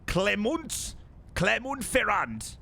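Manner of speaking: Clement Ferrand in a hammed-up French accent, drawn out slowly; jokey French accent, slowly savouring the name; mock French accent. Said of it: "put on the accent to make it sound somewhat more French".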